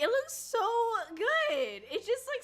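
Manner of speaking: high, loud gushing over the print; excited gush. Real, not acted. Streamer gushing to chat about her magazine print, high and excited. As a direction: squeal with delight at the magazine.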